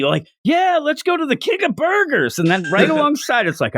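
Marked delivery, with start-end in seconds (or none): 0.4-2.4 high-pitched put-on cheer; phony enthusiasm. Character putting on a front about going for burgers, phony enthusiasm. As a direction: gush it in a fake, far-too-eager voice. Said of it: "almost like phony".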